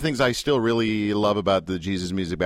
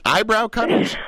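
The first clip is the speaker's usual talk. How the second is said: much higher, repeated disbelief; high incredulous echo; 10 semitones higher, 3 dB louder, slower than usual. Real, not acted.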